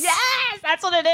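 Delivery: high, excited agreement. Real, not acted. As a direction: squeal it in a high voice. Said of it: "takes her voice to another octave".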